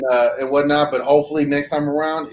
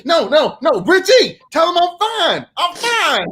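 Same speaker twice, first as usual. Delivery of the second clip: mock panicked plea; 13 semitones higher, slower than usual. Guest to host, mock panicked high plea. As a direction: pretend to beg your host to vouch for you.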